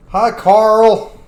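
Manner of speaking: loud, high hello called out; playful high-pitched call; mock greeting. Cheery mock greeting to game character.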